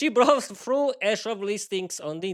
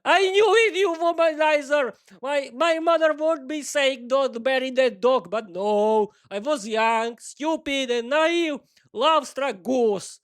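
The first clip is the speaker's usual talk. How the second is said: much higher, loud lamenting wife; lamenting wail; 7 semitones higher, 3 dB louder, slower than usual. Acted. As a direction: wail it out in outrage. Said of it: "begin lamenting even more".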